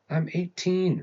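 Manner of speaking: shouted. A narrator's reading. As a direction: yell back at dad in protest. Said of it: "Wren shouted".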